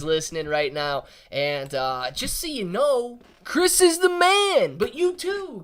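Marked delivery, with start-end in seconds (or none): 3.4-5.6 hyped praise. Real, not acted. Hyping up a friend.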